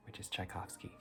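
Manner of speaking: soft whisper so nobody wakes; hushed night-time whisper; quiet at night. Real, not acted. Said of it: "I'm gonna try and be a little more quiet".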